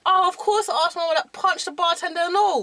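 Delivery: loud, high Cockney rant; worked-up Cockney storyteller; Cockney accent. Shown as a demonstration. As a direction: boast indignantly in Cockney. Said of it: "we're doing Cockney".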